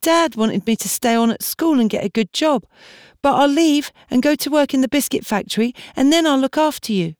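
put-on high, earnest young girl begging to stay; pleading girl. Julie pleads with Martha tearfully.

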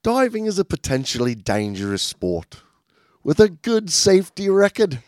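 hammed-up British accent, pitch jumping high at the end; mock British accent. Shown as a demonstration. Cohost asks for British voice, he hams a British accent. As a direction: read it like a posh Brit. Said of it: "you're supposed to do that in the British voice".

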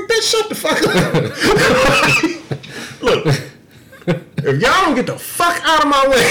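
high laughing retort; telling off the girls while cracking up; laughing threat. Retold or imitated. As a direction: laugh through the tough talk.

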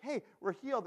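high, loud burst of excitement; excited lepers. Acted. Lepers tell each other they are healed, excited. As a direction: call it out to fellow lepers, thrilled. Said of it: "they're, like, all excited about it".

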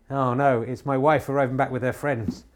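normal talking voice

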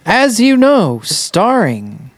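booming mock movie-credits announcer. Podcast host mock-reading the show's credits, announcer voice. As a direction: announce the show title like the end credits. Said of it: "read the credits out loud".

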